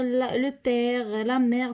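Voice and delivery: ordinary delivery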